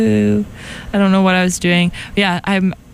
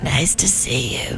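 lost voice; 6 semitones lower, 6 dB quieter than usual. Retold or imitated. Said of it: "I'm like".

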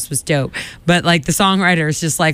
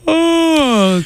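talking through a yawn; 12 semitones higher than usual. Real, not acted. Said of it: "can you hear me yawning?".

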